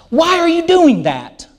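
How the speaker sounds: put-on high-pitched, exasperated question to a child. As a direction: question a misbehaving child in disbelief. Adult questioning a child's misbehaviour.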